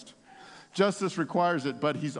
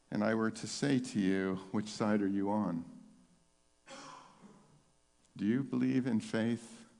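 soft, low and intimate invitation; soft altar call; 7 semitones lower, 7 dB quieter than usual. Real, not acted. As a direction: draw them in gently to trust.

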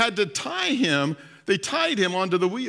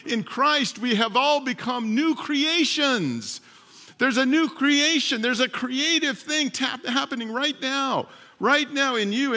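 higher, driving sermon voice; impassioned preaching; 4 semitones higher than usual. Real, not acted. Preacher telling the room God makes new creations, impassioned preaching. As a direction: build on each repeated phrase and proclaim it to the room.

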